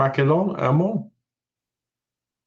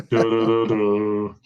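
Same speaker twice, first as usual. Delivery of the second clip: low, slow sung "du-du-du-du"; imitated low soundtrack sting; mock dramatic sting; 5 semitones lower, slower than usual. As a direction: hum out a deep, drawn-out film cue. Mimics film cue after time jump.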